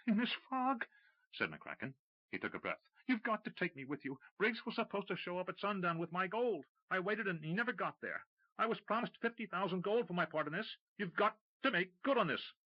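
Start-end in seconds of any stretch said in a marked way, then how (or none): 0.1-0.9 high, loud panicky retort. Acted. McCracken answering Tim, high panicky retort. 3.1-12.5 high, desperate plea; pleading. Acted. McCracken pleading with Tim for his gold, desperate and high.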